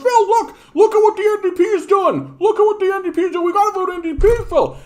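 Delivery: breathless-with-news falsetto pleading; Bubbles. Acted. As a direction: urge a friend in an excited, very high squeak. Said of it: "gets to his friend and goes".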